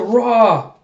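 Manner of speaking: loud roaring battle cry; war cry. Acted. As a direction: charge in with a yelled roar. Den's war cry while charging.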